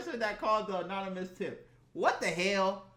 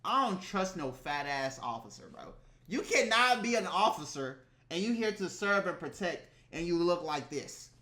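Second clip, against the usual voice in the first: normal talking voice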